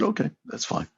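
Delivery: breezy, unbothered okay; light mock acceptance. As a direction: shrug it off lightly. Retelling his reply to her refusal.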